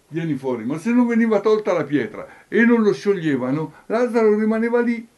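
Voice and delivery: high, loud preacher's crescendo; impassioned preaching. Real, not acted. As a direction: build to a shouted climax.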